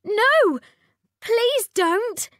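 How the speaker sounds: high, loud panicked plea; begging in alarm; pleading princess. A narrator's reading. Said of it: "said the princess".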